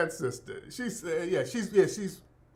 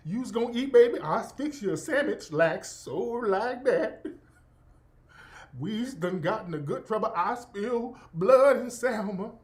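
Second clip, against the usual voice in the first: exaggerated Southern accent; 3 semitones higher than usual. Streamer mimicking a cooking influencer, exaggerated Southern accent. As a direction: lay the Southern accent on thick, like a TikTok cook. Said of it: "She's going overboard with the accent".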